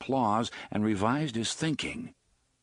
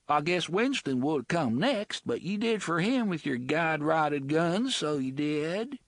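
high, coarse dialect woman's voice; rough slum woman; 8 semitones higher than usual. Acted. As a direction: sullen bloody woman voice. Bloody woman character voice.

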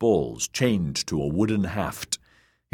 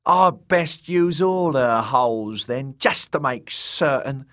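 leering jester; 7 semitones higher, 4 dB louder than usual. A narrator's reading. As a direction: gloat lewdly. Shagwell leering.

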